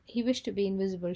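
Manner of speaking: plain reading voice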